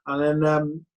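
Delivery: ordinary talk, nothing marked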